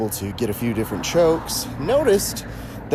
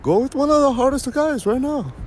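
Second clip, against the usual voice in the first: imitating his instructor, pitched way up. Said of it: "who was like".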